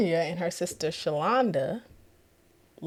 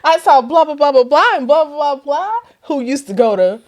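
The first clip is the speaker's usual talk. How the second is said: gushing sister; 5 semitones higher, 8 dB louder than usual. Retold or imitated. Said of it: "She's like".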